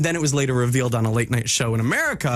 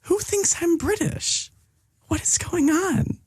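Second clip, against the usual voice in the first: high, drawn-out disbelief; playfully astonished, stretching the questions high; incredulous; 13 semitones higher, slower than usual. Real, not acted. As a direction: pitch shoots up in baffled protest. Mock disbelief at the game result.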